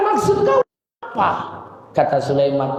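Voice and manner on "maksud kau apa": sharp demanding shout. Acted. Zubaidah demanding Sulaiman explain himself, high shout. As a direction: demand an answer from him.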